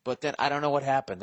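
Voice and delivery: usual speaking voice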